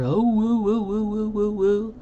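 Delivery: high, howling husky talking back. Retold or imitated. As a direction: yodel it like a chatty husky. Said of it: "She just does her".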